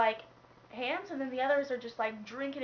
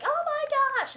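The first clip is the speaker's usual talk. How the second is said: panicked shout; 9 dB louder than usual. Retold or imitated. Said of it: "And then it's just like".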